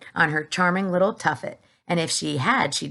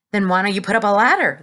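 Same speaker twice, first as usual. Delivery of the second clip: needling; 3 semitones higher, 7 dB louder, faster than usual. A narrator's reading. Police chief needling.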